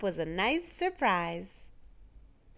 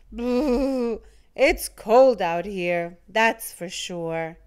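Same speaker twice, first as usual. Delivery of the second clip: shiver through it like a freezing snowman; cold little snowman voice; 5 dB louder, slower than usual. A narrator's reading.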